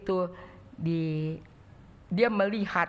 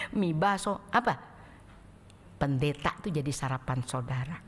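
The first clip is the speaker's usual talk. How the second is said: normal talking voice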